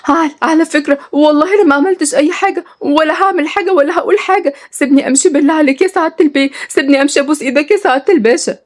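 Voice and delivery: high, nervous begging; stammer, then beg to be released; frightened pleading. Acted. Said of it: "بلعت نغم ريقها بتوتر وسكتت وهي خايفة".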